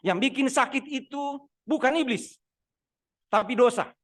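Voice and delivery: loud, slow, high proclamation; forceful preaching. Real, not acted. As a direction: hammer home the point. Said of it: "preaching emphasis". (khotbah lantang dan lambat)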